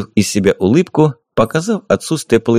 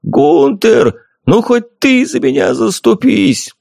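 high, slow desperate yell for help; sudden yell; 12 semitones higher, slower than usual. A narrator's reading. Flossi yells to Gunther for help, a high slow plea. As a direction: cry out to Gunther, pleading. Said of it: "внезапно заорал".

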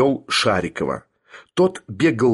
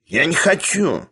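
higher-pitched, cross protest; spiteful refusal; 6 semitones higher than usual. A narrator's reading. Sharikov balks at staying behind, spiteful. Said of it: "злобно отозвался Шариков".

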